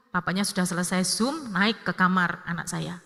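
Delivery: ordinary delivery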